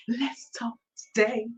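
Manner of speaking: breathy secret whisper, no voice. Shown as a demonstration. Teasing the listeners by whispering the show's opener. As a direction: lean in and whisper it. Whispered then repeated aloud.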